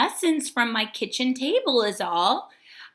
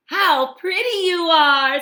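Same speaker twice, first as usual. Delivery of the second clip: high and loud delight; admiring Hermit Crab; 7 semitones higher, 9 dB louder than usual. A narrator's reading. Crab admiring the coral, high and loud. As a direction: gush over the coral. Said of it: "said Hermit Crab".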